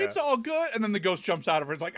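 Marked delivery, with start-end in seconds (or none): 0.0-0.7 high-pitched mock reassurance; put-on high voice, no extra volume; breezy quote. Possessed grandma brushing off the zap, mock breezy.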